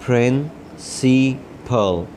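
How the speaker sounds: Principal drawn out very slowly; slow careful syllables. Shown as a demonstration. Teacher modelling principal slowly for the class. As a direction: stretch principal sound by sound.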